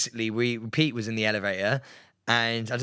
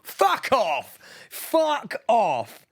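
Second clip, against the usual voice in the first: shocked disbelief; 10 semitones higher, much slower than usual. Real, not acted. Shocked by new presenters news.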